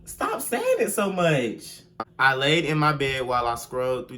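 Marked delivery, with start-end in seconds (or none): 0.0-1.8 exasperated plea. Real, not acted. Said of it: "Well, y'all".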